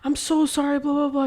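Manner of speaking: high, gushing apology; retold frantic apology.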